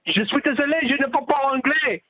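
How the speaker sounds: mock French officer brushing him off; imitating a French-speaking border agent; French border guard. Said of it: "He says, he says".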